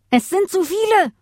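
high, panicked wolf's cry; alarmed yell. A narrator's reading. An outnumbered wolf yells to the pack, alarmed. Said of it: "brüllte ein dritter Wolf".

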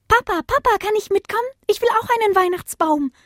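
put-on very high child-like voice; high-pitched, eager little one; pleading puppy junior. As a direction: beg Papa like a tiny pup. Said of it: "fragte Junior".

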